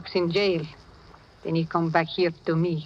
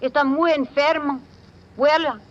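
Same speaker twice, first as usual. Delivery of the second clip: urgent bluff; 6 semitones higher than usual. Acted. Midnight bluffs the Spanish police.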